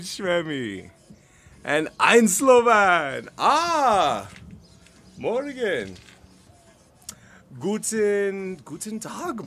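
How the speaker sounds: put-on loud, drawn-out playful German-accented hollering; big booming mock-German greeting; hammed-up German accent. Streamer greeting German viewers in chat, hammed-up German accent.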